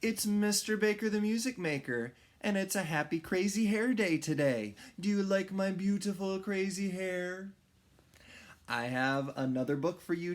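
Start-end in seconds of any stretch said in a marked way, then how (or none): 0.0-7.5 bright, fast, high storyteller greeting; cheery kids' host. Acted. Music teacher greeting his first graders, bright and fast.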